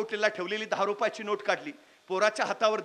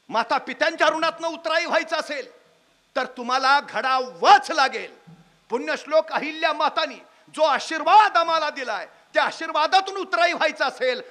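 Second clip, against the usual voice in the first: loud rousing sermon, each clause hammered home; fiery public-speech call to duty; preaching at full force; 6 dB louder than usual. Acted. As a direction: preach it to the crowd with fire. Rousing appeal to the audience.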